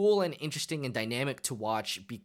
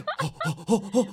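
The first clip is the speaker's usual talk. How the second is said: high, exaggerated gasp of shock; mock gasp; 9 semitones higher, 4 dB louder than usual. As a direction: gasp like the twist just landed. Mimics shock at the twist.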